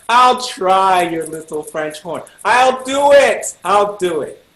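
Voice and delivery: loud, drawn-out mock bravado; hammed-up taking of a dare; defiant volunteering. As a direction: draw the words out, rising to a loud peak. Retells defiantly volunteering for horn.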